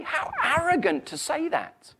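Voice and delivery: high-pitched mock outrage; indignant disbelief. Doubter reacting to Jesus's exclusive claim, high indignant disbelief. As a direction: pitch it up as a scandalized objector. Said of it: "you'll think".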